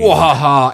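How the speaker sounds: loud "wahaha" cackle, played for fun; mock villain laugh. Podcaster pitching a Valentine's event villain, mock villain laugh. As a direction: crow a cartoon villain's laugh.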